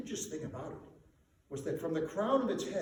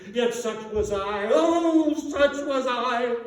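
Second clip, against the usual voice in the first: loud, high, wailing lament; anguished ghost; 4 semitones higher, 9 dB louder than usual. A narrator's reading. Marley's ghost lamenting his life to Scrooge, wailing. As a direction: wail over a wasted life.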